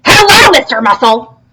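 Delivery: loud, high insistent hello. Acted. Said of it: "I said".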